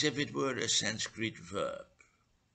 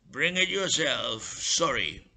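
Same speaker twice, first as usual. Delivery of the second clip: nasty smile; 4 semitones higher, 7 dB louder than usual. Acted. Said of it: "with a somewhat nasty smile".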